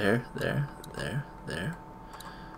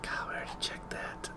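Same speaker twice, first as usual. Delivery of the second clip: frustrated whisper; 16 dB quieter than usual. Real, not acted. Frustrated, searching again.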